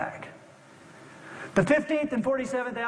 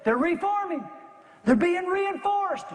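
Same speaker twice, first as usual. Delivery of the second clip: urgent calling out; 9 semitones higher than usual. Acted. Young lookout warns the officers of reinforcements, urgent high call. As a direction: call out the warning, pitch jumping up. Lookout calls out enemy's approach.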